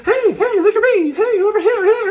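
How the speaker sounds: giggly high spirit voices; beings calling out. Retold or imitated. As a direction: play pushy cartoon creatures begging attention.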